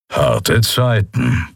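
slow, grumbling sarcastic remark; bitter sarcasm. Acted. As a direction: let the bitterness drip off it slowly. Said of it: "brummte Kero mit galligem Sarkasmus".

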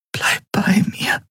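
slow tender whisper. Acted. Endriel begs Kai to stay, whispering. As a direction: breathe it to him. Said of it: "flüsterte sie".

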